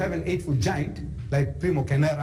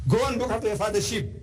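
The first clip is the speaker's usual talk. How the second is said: higher, quick brush-off again with more push; a touch more scornful commander; dismissive commander repeated; 8 semitones higher, faster than usual. Retold or imitated. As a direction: shoo him away firmly. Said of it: "So what? You?".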